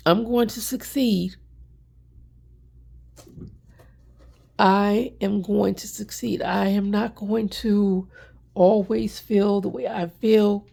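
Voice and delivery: emotional. Real, not acted. Emotional, fighting tears.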